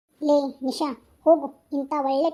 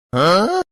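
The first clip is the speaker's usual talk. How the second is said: loud burst of laughter; 9 semitones lower, 10 dB louder than usual. Acted. Friend bursts out at the joke.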